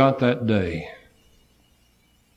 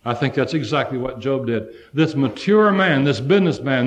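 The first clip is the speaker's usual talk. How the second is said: ordinary talk, nothing marked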